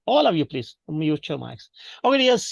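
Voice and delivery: ordinary delivery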